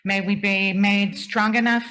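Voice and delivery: ordinary talk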